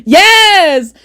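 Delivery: high, drawn-out mock proclamation; Doctor Umar. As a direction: proclaim it in a hammed-up impression. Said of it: "Doctor Umar voice".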